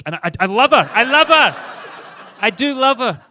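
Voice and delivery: loud, high-pitched insistence; emphatic protest. Pretend. Husband insisting he loves the wife he mocked, shouted protest. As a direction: shout it as if defending yourself.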